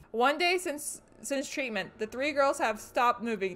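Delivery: diary reading. Acted. Streamer reading the doctor's diary aloud, quiet reading. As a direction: read the notes low and eerie. Reads in-game diary notes.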